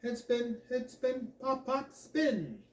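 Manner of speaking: high, even calling of dance steps; French dance teacher counting off moves; Madame Lamont instructing. A narrator's reading. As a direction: build to a falling final word. French teacher calls the big finish.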